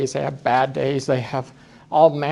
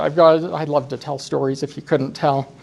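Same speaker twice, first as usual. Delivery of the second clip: ordinary talk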